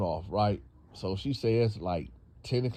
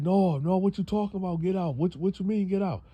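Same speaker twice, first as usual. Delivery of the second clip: high protesting man; 9 semitones higher than usual. Retold or imitated. Boyfriend protests being thrown out.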